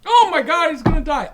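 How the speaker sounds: high, loud, frantic; he's gonna die, panic; panicked alien. Acted. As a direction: alien guard shouting in laughing panic. Guard panics.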